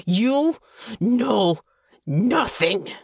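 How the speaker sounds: furious soldier shakes the noble on each word, pausing between them; three separate stressed words. A narrator's reading. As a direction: punch "You" ... "know" ... "nothing" with pauses between. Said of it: "With these last three words".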